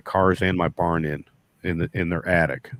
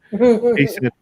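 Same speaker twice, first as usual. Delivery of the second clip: ordinary talk, nothing marked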